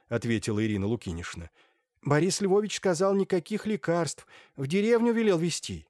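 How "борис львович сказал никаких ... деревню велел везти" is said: high-pitched woman's voice; Irina Lukinishna. A narrator's reading. Irina passes on the doctor's orders, high character voice. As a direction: report it in a high, insistent register.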